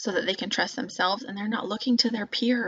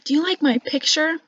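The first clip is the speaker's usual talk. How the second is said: put-on child's voice; 7 semitones higher, 5 dB louder than usual. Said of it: "and they'll say".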